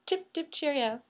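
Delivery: soft mock British accent; American putting on British. Shown as a demonstration. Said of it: "and I'll do".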